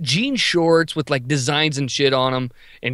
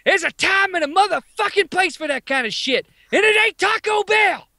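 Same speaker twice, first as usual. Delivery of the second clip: high-pitched mock telling-off; scolding cashier; 14 semitones higher than usual. Cashier to the screaming kid, high hollered scolding. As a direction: holler it as the fed-up woman at the register.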